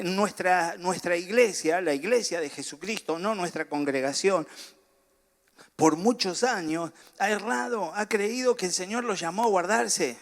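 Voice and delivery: ordinary delivery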